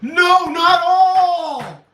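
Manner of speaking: loud high, very slow yell; a real amused outcry; drawn-out shout. Real, not acted. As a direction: stretch the protest out loud. Laughing outburst at a chat comment.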